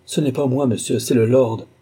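usual speaking voice

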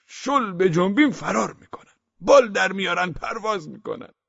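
high, loud appealing account; as if seeking help. A narrator's reading. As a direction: tell it like a plea for help. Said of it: "با لحنی که انگار کمک بخواهد".

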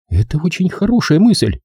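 curt woman, pitched high; snippy high-voiced approval; sharp reply. A narrator's reading. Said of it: "сказала она резко".

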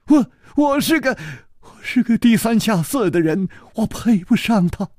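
put-on timid young servant. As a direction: falter out the words, ashamed. Yijian stammers.

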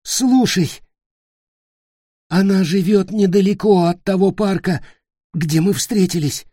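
high, loud, pressing each detail; urgent, strained instructions. Acted. Dying Nick urgently telling Peter where mother lives. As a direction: drill the address into him.

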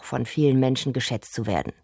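ordinary talk

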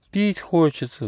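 high little whine; whimpering. A narrator's reading. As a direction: whimper like a thirsty little hedgehog. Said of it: "захныкал ежонок".

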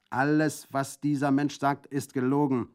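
loud, firm denial, gelogen falling hard; resolute. Acted. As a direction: flatly insist it is a lie. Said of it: "erklärte Orlanduccio entschlossen". (entschlossen und laut, „gelogen“ fallend betont)

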